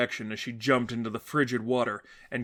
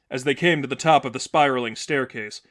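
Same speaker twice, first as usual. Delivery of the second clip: usual speaking voice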